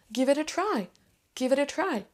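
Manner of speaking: high-pitched GIVE IT A TRY run together; smooth linked model of GIVE IT A TRY; linked words. Shown as a demonstration. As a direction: join GIVE IT A into 'givita', falling on TRY. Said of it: "lier les mots entre eux".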